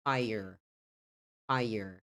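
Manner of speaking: American "fire" with a clear r; American pronunciation. Shown as a demonstration. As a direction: say fire as "FY-er", r fully sounded. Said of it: "Bem pronunciado o som do R".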